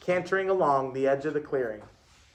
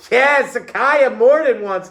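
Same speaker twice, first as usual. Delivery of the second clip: loud, high classroom call; 7 semitones higher, 9 dB louder than usual. A narrator's reading. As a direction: call it out loudly across the class. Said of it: "Dominique called out".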